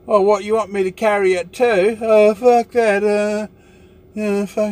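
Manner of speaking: high, hammed-up "carry it too?" outrage, slowing on fuck that; mock whinge. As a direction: imitate a lazy bloke refusing the help he's offered. Mocking a lazy newcomer's reply.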